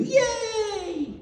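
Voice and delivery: put-on high, loud, squealed child cheer; imitated child's cheer. Imitating his granddaughter before she runs off, delighted squeal. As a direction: cheer like a delighted little girl.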